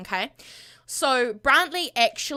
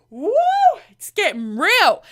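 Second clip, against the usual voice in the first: excited hype; 8 semitones higher, 7 dB louder than usual. Real, not acted. Narrator hyping drama.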